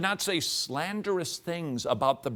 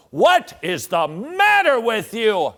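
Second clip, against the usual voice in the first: slow, loud, fed-up scold; exasperated scolding; 6 semitones higher, 11 dB louder than usual. Acted. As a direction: bark it at a sinner.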